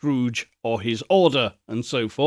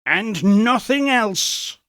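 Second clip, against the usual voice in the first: triumphant; 8 semitones higher, slower than usual. Acted. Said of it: "said Scrooge triumphantly".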